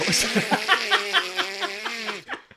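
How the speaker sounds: very high, long squealing laugh; helpless laughter. Real, not acted. Laughing at a joke about the failed marriage, helpless high laughter.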